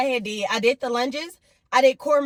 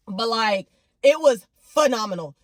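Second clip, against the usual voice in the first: ordinary talk, nothing marked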